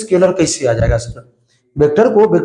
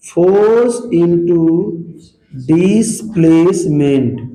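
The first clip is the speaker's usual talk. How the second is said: slow dictation; much slower than usual. Shown as a demonstration. Teacher dictating the work formula, very slow. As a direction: force into displacement drawn out very slowly. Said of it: "वो होता है".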